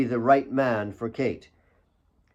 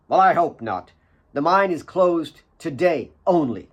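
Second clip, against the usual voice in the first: a touch louder, clipped and cool; curt reply; 5 semitones higher, 5 dB louder than usual. A narrator's reading. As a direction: cut her off with a cool edge. Claire answers Mary sharply.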